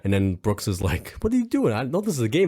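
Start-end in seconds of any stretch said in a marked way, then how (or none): 1.2-2.5 higher-pitched, indignant complaint; mock protest. Brooks protesting to the intruders at his door, mock protest. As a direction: imitate a baffled homeowner objecting, higher.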